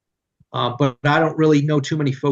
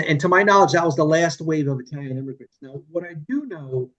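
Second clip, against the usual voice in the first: usual speaking voice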